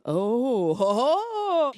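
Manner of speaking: high, mock leering laugh; a hint of a leering catcaller. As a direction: leer at her like a creep. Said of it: "they'd be like".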